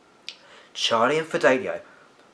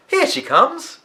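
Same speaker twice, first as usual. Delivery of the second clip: excited; 7 dB louder, slower than usual. A narrator's reading. Fidelio sees Olivia coming.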